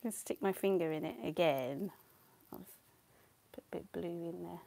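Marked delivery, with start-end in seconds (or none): 2.5-4.6 softer whispered note to self; quiet whispered aside. Real, not acted. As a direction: murmur it privately, no voice. Whispering to herself while painting.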